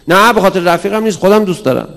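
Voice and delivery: put-on loud, high defensive child; insistent child. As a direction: protest it's your own taste.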